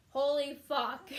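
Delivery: loud, slow, stunned curse; a slow loud gasp of a curse; shocked outburst. Retold or imitated. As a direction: blurt it out in shock at the mirror. Shocked seeing face in mirror.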